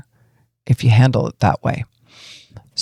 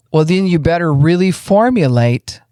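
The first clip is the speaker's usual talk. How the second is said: ordinary talk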